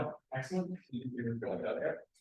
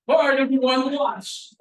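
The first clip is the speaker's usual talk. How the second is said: loud, high call to the whole group; 12 semitones higher, 17 dB louder, slower than usual. Real, not acted. Coach gathers the players to watch, calling out. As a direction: holler for everyone's attention.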